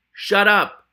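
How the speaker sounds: furious loud shut up; shouted shut up. Acted. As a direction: finally explode at him. Ratcatcher finally snaps.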